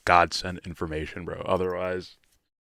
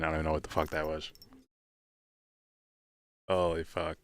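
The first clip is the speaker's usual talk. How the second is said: ordinary delivery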